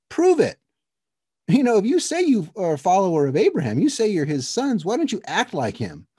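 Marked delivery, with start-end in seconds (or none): 0.1-2.8 sharp high loud challenge. Acted. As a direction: throw it at them as a dare. Jesus challenges the leaders.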